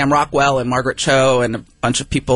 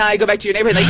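ordinary delivery

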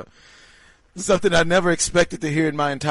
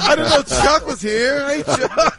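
higher, louder TV host voice; Ernie; 8 semitones higher, 5 dB louder, slower than usual. Retold or imitated. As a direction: greet the panel like a studio host. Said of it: "Ernie, why we got Snagglepuss".

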